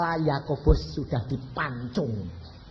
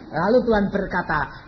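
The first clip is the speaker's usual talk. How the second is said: ordinary talk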